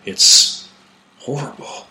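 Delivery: shaken, breathy near-whisper; horrified. Acted. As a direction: barely get the words out, voice dropping away. Shaken reply after horrifying sight.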